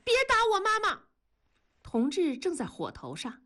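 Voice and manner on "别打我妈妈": put-on very high-pitched child's cry; shouted plea. A narrator's reading. Child clings to father defending mother, shouting. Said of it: "大喊".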